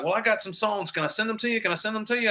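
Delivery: high, lightly imitated South African accent. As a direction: imitate an eager South African pitching songs. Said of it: "he talked with a South African accent".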